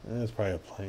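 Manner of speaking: quiet, offhand shrug of a line; soft, indifferent aside; unimpressed. Acted. Imagined second unimpressed onlooker.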